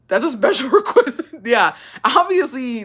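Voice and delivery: laughing through the words; giggling while reading the comment out. Real, not acted.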